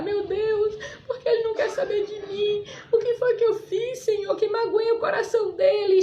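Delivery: reenacted high-pitched weeping plea to God; imitating a sobbing prayer; tearful crying. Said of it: "eu comecei a chorar".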